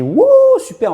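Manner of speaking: high, loud "wouh" of delight; mock excited cheer. Coach imitating people cheering for the exercise, excited whoop. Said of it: "la moitié des gens qui crient".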